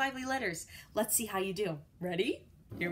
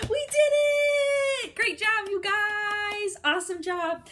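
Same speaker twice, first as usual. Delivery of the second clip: high, loud, slow victory shout; excited cheer; 9 semitones higher, 6 dB louder than usual. Real, not acted. Teacher cheers kids at the end of the drill.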